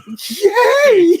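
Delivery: high, loud laughing yay; delighted shout; laughing cheer. Real, not acted. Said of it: "cheering the husband".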